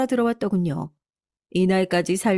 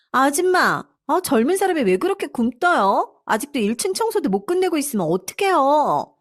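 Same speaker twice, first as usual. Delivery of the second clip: high, exasperated scolding; mild angry yell; 8 semitones higher than usual. Acted. Daughter-in-law berating the housekeeper for slowness, in a mild high-pitched yell. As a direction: berate the help in a raised-pitch mock yell. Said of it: "며느리의 고함 소리가".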